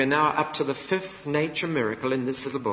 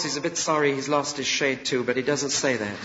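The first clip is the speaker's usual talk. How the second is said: usual speaking voice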